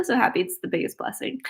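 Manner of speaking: ordinary talk, nothing marked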